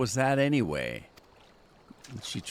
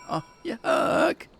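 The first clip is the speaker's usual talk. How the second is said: a much higher, squealing yuck of disgust. Real, not acted. Forced to oil up a companion, a high disgusted squeal.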